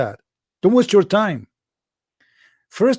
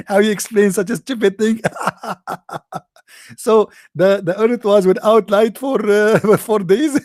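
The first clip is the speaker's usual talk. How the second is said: higher, louder, laughing through it; mocking laugh; 5 semitones higher, 3 dB louder than usual. Mimicking the caller who mocks creation, laughing high. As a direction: laugh through the words in mockery.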